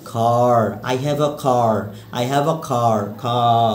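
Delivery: "car" with a long, open aa; long aa sound. Shown as a demonstration. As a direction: stretch the vowel in "car". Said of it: "long আ sound".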